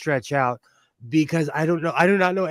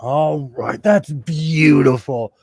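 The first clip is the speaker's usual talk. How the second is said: slow, lowered, pouring-it-on purr; chocolatey voice; 5 dB louder, slower than usual. Pretend. To the camera about his sewing, a slow chocolatey voice. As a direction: lay the charm on thick and slow. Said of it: "Should I use my chocolatey voice".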